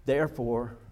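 ordinary delivery

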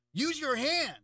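high, loud mock scream of a stranger; imitating a sudden high yell; retold scream. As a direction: bark it like the startled woman at the sushi bar. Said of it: "the woman next to me screamed at me".

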